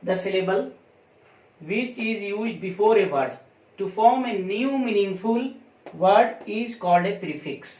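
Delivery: slow, word-by-word dictation pace. Shown as a demonstration. Teacher dictating a definition to students, slow and deliberate. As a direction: spell it out slowly so students can write.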